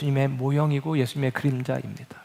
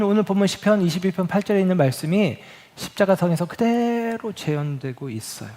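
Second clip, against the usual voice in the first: normal talking voice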